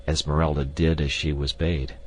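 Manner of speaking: ordinary delivery, nothing marked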